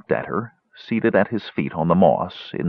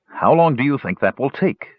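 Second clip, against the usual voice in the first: impatient; 6 semitones higher than usual. A narrator's reading. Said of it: "pressed somewhat impatiently".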